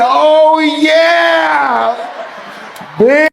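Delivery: high, loud, stretched-out imitation; hammed-up high holler; mock shout. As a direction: holler it like a hyped-up girlfriend. Acts friend's reply, shouted.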